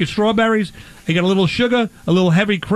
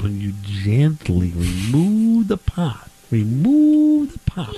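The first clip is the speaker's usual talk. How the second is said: hammed slow; 3 semitones lower, much slower than usual. Hammed instruction, laugh after.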